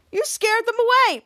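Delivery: high, mildly indignant reproach; acted scolding. Chopper at the door blames Ray, acted scolding. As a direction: lift the pitch in mock reproach.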